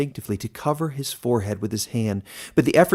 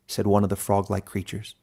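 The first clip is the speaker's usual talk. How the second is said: ordinary delivery, nothing marked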